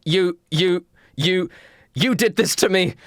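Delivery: accusing little Timmy. Acted. Little Timmy confronts William, accusing. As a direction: stammer the accusation at the culprit. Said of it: "stares at William and goes".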